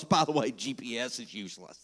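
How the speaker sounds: low, hushed throwaway comment; muttered aside. Real, not acted. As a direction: drop right down into a confidential aside. Comic aside about GPS.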